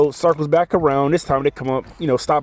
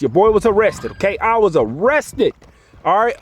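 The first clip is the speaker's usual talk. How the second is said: ordinary delivery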